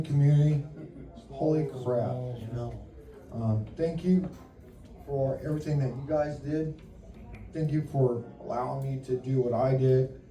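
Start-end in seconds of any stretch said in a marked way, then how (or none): none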